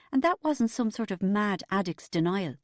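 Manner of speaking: usual speaking voice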